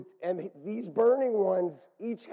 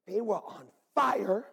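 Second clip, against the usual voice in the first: normal talking voice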